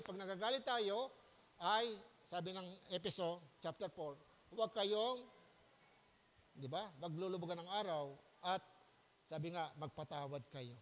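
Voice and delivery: ordinary talk, nothing marked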